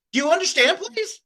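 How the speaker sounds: pleading rise jumping high on please; mock pleading scammer. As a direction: beg it, voice climbing up.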